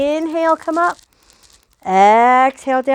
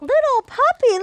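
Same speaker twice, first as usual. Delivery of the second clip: delighted high confirmation; 10 semitones higher than usual. Acted.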